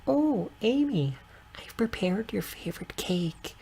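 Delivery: high breathy whispery aunt voice; squealing baby talk aunt. Acted. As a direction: coo it like a doting aunt. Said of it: "Auntie Gwen's voice".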